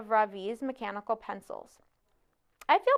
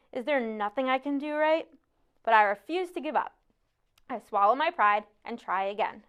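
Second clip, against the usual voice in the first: usual speaking voice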